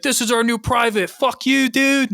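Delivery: very high-pitched, hammed-up soldier banter; rowdy mock soldiers. Acting out rowdy British soldiers' horseplay.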